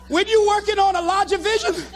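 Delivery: high, choked-up speech; mock tearful preaching. Jilted motivator back before the crowd, tearful. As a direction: keep speechmaking through acted heartbreak. Resumes speech heartbroken after breakup.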